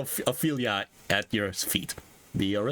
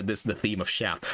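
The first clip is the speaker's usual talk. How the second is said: usual speaking voice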